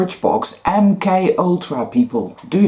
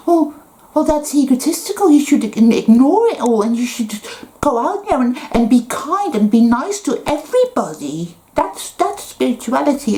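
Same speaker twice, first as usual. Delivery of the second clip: high, fast, sing-song mockery of a preachy spiritual type; mocking new age voice; 9 semitones higher than usual. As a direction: parody the sanctimonious nice-everybody crowd, pitched up. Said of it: "some people will say".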